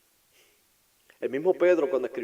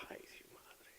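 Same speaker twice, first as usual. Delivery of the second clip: breathless whisper of alarm; whispered dismay; 26 dB quieter than usual. Acted. Peter seeing Jesus arrive, a whispered ay madre. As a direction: gasp it out under the breath.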